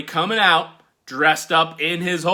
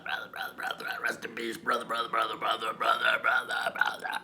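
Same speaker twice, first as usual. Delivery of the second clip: fading echo; faster than usual. Acted. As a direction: let it echo away into nothing.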